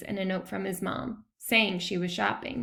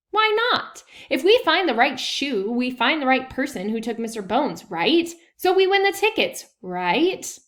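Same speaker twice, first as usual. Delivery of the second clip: eager excitement; 4 semitones higher, 7 dB louder than usual. Acted. Josh eager about winning tickets.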